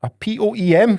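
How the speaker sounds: P O E M spelled letter by letter, loud and high. Retold or imitated. Spelling P O E M loudly for his confused girlfriend. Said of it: "I went".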